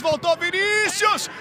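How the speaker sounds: long drawn-out goal yell; goal shout. Real, not acted. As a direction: stretch the goal cry out. Goal call for Vasco. (grito de gol longo)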